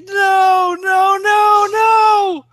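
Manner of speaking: high, loud repeated no, breaking into a scream; anguished cry. Acted. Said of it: "and he's like".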